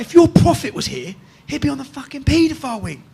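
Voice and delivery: high-pitched, half-volume mock scream; retold screaming. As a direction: re-enact yelling out of a window, held back. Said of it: "And then I'm screaming".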